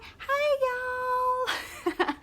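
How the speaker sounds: high, sugary drawn-out Southern hello; sweet Southern greeting. Retold or imitated. Vlogger imitating a country singer's sweet greeting, high and sugary. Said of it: "she talks so sweet".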